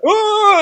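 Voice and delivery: high, loud mock kid's shriek; freaked-out scream. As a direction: imitate a frightened little boy screaming. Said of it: "He's a little kid".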